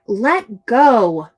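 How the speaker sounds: loud, harsh "Let go."; demanding child; bossy order. Shown as a demonstration. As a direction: bark it like a bossy kid. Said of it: "A bossy friend might say".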